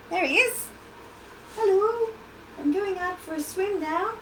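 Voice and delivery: high cheery little crab voice; a puppet crab saying hello. Pretend.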